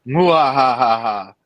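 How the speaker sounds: slow evil cackle; hammy villain laugh, drawn out; mad scientist. Pretend. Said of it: "My evil".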